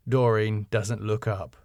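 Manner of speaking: ordinary talk, nothing marked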